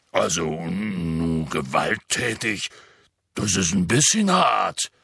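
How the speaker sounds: deep voice, flustered; low rough protest; Hagrid voice, agitated. Acted.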